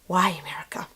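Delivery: a soft unvoiced whisper of disbelief; whispered aside. Real, not acted. Vlogger whispers to viewers in exasperated disbelief. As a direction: breathe it out, shaking your head at America.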